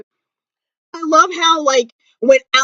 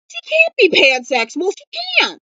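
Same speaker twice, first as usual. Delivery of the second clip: squeaky protest; 6 semitones higher than usual. Real, not acted. Protesting Izzy's pansexuality.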